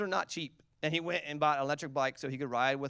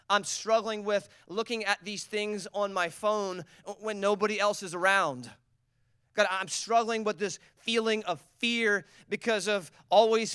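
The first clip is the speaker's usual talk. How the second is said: pleading prayer; 6 semitones higher, 5 dB louder than usual. Acted. Congregant praying aloud.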